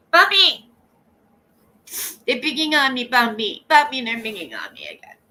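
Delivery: loud, high mock cry for help; imitating a complaining woman. Mimicking her calling for rescue from bullies, loud mock whine. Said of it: "I do sound like her".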